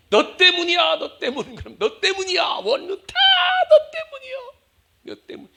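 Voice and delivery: high, whiny blaming that breaks into a sob; mock tearful blame. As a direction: cry out "it's your fault".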